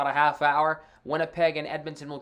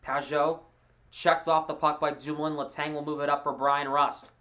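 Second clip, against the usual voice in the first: play-by-play. Acted. Live hockey play-by-play.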